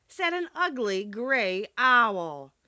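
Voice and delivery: plain reading voice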